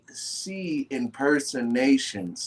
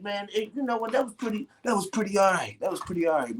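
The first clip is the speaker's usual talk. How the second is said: grudging approval; 9 semitones higher, faster than usual. Retold or imitated. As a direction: imitate a man giving high-pitched praise. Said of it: "my man's like".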